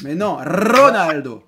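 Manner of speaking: Ronaldo with a slow rolled R. Shown as a demonstration. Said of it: "ne sait toujours pas rouler les R".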